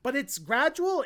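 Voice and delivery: ordinary talk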